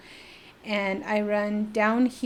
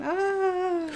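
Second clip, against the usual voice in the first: put-on high, drawn-out girlish squeal; re-enacted fangirl squeal; excited squeal; 10 semitones higher than usual. Teen squeal on hearing her name.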